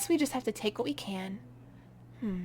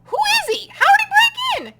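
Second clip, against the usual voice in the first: panicked; 13 dB louder than usual. Acted. Intruder at foot of bed.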